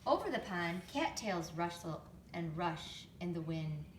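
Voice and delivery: ordinary delivery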